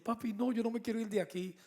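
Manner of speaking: daughter's protest. Retold or imitated. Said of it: "cuando me dice yo".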